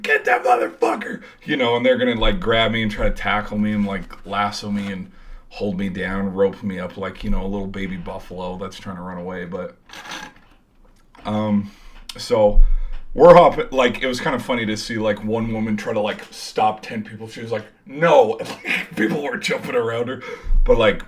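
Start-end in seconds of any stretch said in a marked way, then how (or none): 0.0-1.2 rough, raspy pursuit shout; mock yell. Guards spotting him jump onto the field, mock yell. 17.9-18.3 loud, higher cry of refusal; mock shout. One woman trying to stop ten people, mock shout.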